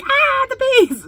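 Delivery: high, slow squeal of joy; drawn-out excited ah; delighted squeal. Retold or imitated. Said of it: "I was like".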